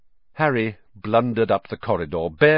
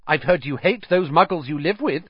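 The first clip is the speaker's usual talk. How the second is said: swift retort; 7 semitones higher than usual. Acted. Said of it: "said Ernie swiftly".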